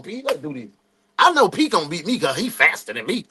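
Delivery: usual speaking voice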